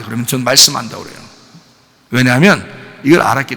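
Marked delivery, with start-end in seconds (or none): none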